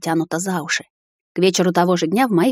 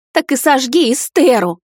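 loud, high, slow bark; 6 semitones higher, 5 dB louder than usual. A narrator's reading. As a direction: bark it at him. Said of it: "рявкнула мама". (мать рявкает на сына, громко и медленно)

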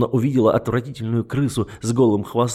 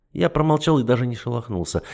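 ordinary delivery, nothing marked

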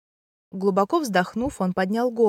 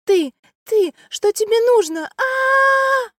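much higher, louder panicked scream; terrified shriek; 6 dB louder, slower than usual. Acted. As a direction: shriek in terror in the dark. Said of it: "пронзительно завизжала".

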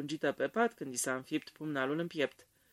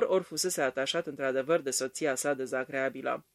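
usual speaking voice

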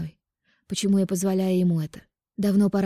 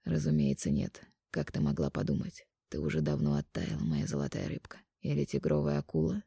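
soft, low man's voice; quiet intimate male lover; 14 semitones lower, 8 dB quieter than usual. Acted. Mark teases.

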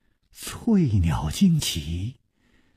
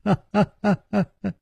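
high ha-ha laugh tailing off; hearty laugh; 9 semitones higher, much faster than usual. A narrator's reading. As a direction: laugh on after teasing a friend. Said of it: "南宫灵也大笑". (哈哈大笑)

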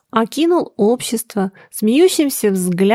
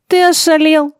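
high, indignant outburst; angry shout; 8 semitones higher, 4 dB louder than usual. A narrator's reading. Garushin, nearly knocked down, yells at the groom angrily. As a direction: snap at him with raised pitch. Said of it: "гневно крикнул на него Гарушин".

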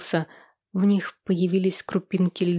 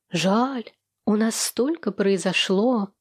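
plain reading voice

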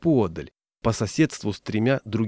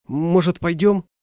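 impatient; 7 semitones higher, 3 dB louder than usual. Acted. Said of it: "нетерпеливо сказал Лои".

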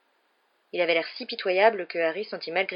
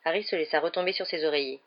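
ordinary delivery

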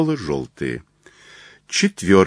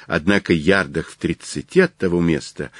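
ordinary delivery, nothing marked

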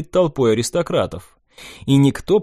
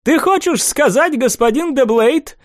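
put-on high ringing voice of a gruff old prince; sharp high old man questioning; 12 semitones higher, 3 dB louder than usual. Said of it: "слишком звонким для своих лет голосом".

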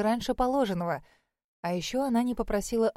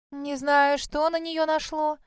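grumbling; 7 semitones higher, 3 dB louder, slower than usual. Acted. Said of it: "проворчала Синтия".

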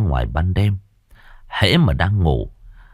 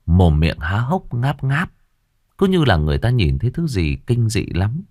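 usual speaking voice

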